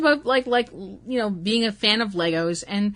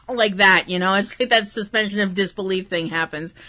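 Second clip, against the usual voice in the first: normal talking voice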